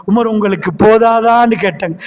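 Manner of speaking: ordinary talk, nothing marked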